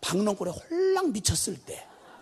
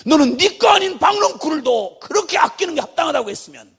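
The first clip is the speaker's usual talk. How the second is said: loud rebuke; 7 semitones higher, 6 dB louder than usual. Acted. God rebuking Jonah.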